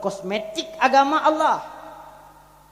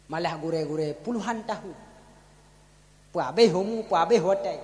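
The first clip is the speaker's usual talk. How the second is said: usual speaking voice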